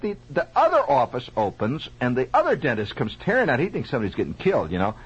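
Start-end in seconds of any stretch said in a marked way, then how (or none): none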